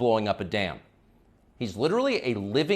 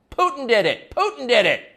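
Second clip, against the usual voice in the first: high, loud parroting chant; mock pundit chorus; 10 semitones higher, 6 dB louder than usual. Host mimics pundit class blaming Putin, mock chorus. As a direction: mockingly squawk it like a chorus of pundits. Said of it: "clearly coordinated noises".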